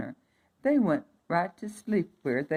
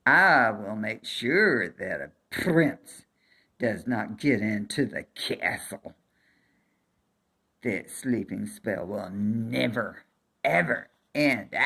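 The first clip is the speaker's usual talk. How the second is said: loom over the bird and threaten; wicked fairy; 8 semitones lower than usual. A narrator's reading.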